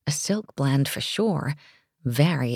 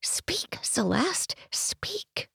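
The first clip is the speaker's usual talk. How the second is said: self-urging whisper; 12 semitones higher, 8 dB quieter, much slower than usual. A narrator's reading. She urges herself inwardly.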